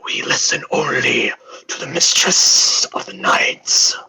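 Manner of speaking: slow, whispered undead guard; death knight voice. Acted. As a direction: whisper it slowly, like an undead servant. Death Knights' cold reply.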